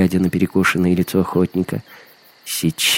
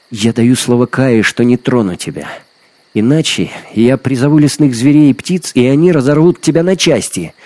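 put-on high, loud girl's warning; raised, heated vow; fierce threat; 5 semitones higher, 5 dB louder than usual. A narrator's reading. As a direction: build to a forceful ultimatum. Girl threatens hunter with beasts.

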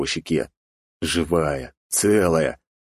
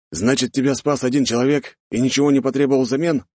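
higher, questioning tone; puzzled father; 8 semitones higher than usual. A narrator's reading. Father questions daughter about her rescuer, puzzled. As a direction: repeat the news as if digesting it. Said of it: "переваривал её слова мужчина".

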